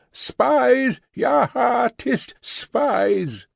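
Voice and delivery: high, shouted jeer; a man in the mob jeering the hearse, shouting. Acted. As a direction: yell at the spies.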